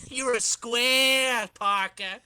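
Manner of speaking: laughing jeer at a square; mocking teasing. Flash mocking Parker as a square, laughing jeer. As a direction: tease him like a school bully.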